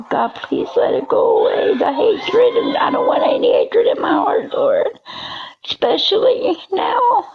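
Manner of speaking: crying prayer. Real, not acted. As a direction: cry out to God through tears. Crying out prayer against hatred.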